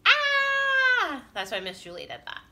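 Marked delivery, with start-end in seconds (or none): 0.1-1.2 high, loud, stretched "aah"; drawn-out scream. Shown as a demonstration. Teacher showing Pre-K all those H's, screaming high. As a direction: scream out every last H. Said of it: "You see all those H's?".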